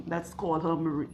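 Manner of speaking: usual speaking voice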